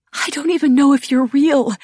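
high, tearful, strained outburst; overwrought, tearful disbelief; laughing and crying. A narrator's reading. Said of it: "I whispered, laughing and crying".

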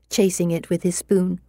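ordinary delivery, nothing marked

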